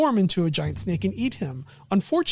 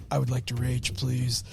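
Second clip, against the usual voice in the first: lower, softer, deadpan politeness; calm barbarian; 6 semitones lower, 4 dB quieter than usual. Retold or imitated. Barbarian shrugging off the punch, low, quiet and polite. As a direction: ask to rage as if ordering tea, low and calm. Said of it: "it's like".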